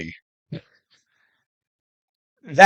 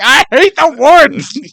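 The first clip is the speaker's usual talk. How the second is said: squeaky high complaint, a bit louder and slowed; high, slow, whiny retelling; high mock whine; 10 semitones higher, 6 dB louder, slower than usual. Quoting the buddy after he falls in.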